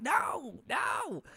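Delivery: very high, soft little "no"s; mock disbelief. Viewer learning the child was an adult actress, mock disbelief. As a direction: squeak it softly in disbelief. Acts out shocked disbelief on being fooled.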